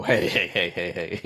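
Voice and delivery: scolding people voice. Retold or imitated. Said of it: "people go".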